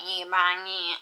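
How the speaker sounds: hammed-up Australian cowboy accent; mock a drawn-out Aussie cowboy accent. Reader imagines book's cowboys talking, mock Australian accent. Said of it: "saying it in an Australian accent".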